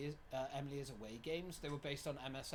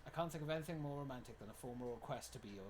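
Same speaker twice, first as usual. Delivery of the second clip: ordinary talk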